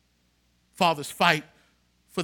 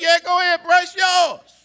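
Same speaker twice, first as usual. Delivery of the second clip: loud, laughing go-ahead; a big laughing yes, bruh; 9 semitones higher, 8 dB louder than usual. Retold or imitated. Barber laughing as he gives the pastor his line, loud.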